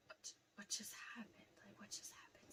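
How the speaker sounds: hushed, breathless whisper; stunned whisper. Retold or imitated. Daughter standing over her dead dad, stunned whisper. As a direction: barely breathe the words in shock.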